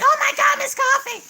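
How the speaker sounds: high, loud mock shock; imitating surprised friend. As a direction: play the friend's shocked delight. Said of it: "she was just like".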